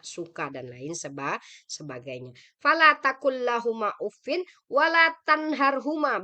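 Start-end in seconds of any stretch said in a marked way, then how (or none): 2.6-6.2 raised, ringing recitation of the verse; chanted Arabic verse, loud and high; Quran recitation. Shown as a demonstration. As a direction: recite it out boldly like a teacher.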